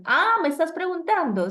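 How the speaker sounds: high, loud "ah" of surprise, then "me estás preguntando"; sudden realization. Shown as a demonstration. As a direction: act out suddenly realizing it is a question. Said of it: "la entonación es la que me indica". (darse cuenta de repente, sorprendido)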